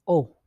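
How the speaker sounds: O said as "oh", falling; O H sound. Shown as a demonstration. Teacher showing the vowel in English terms, falling "oh". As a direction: say O as a round "oh". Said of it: "like the O H sound".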